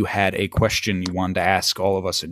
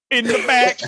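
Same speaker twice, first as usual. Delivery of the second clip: high-pitched imitation of a hillbilly; mock the hillbilly's line up high; amused copy of a hillbilly twang; mimicked hillbilly voice; 12 semitones higher than usual. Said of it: "I like the pause before".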